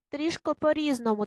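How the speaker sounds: ordinary talk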